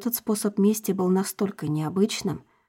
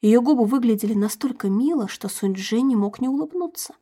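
usual speaking voice

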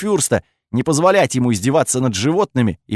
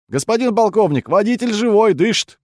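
put-on high, excited young soldier; joyful report; 10 semitones higher than usual. A narrator's reading. Young soldier tells colonel the driver lives, joyful and high. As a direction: report good news eagerly, pitch way up. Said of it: "светилось от радости".

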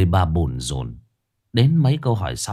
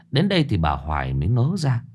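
ordinary talk

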